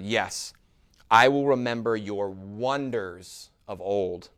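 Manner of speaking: slow, deliberate declaration, falling away at the end; scripture reading. Acted. Preacher reading a psalm to the congregation, slow scripture reading. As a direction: proclaim it phrase by phrase, weighting each one.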